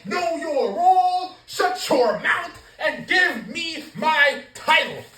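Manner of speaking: high, loud, slow commanding catchphrase delivery; The Rock catchphrase. Retold or imitated. Vlogger wishes The Rock had said his catchphrase to Cody. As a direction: boom it out like the final boss.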